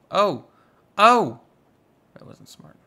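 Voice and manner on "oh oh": loud rising yelp of alarm; sharp shocked cry; startled oh. Real, not acted. Reacting to a mistake with a loud startled oh.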